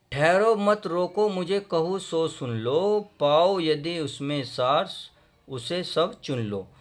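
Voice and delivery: poem recitation. Acted. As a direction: declaim the verse in drawn-out rhythm. Recites verse before explaining. (ओजपूर्ण कविता पाठ)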